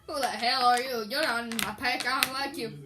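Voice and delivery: a hint of a gruff old man. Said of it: "speaking like an old man".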